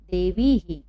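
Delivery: "देवी ही" as one word, ending on a voiced ही; visarga demonstration. Shown as a demonstration. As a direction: echo the long ई after ह in "देवी ही". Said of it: "इसको हम कैसे पढ़ेंगे".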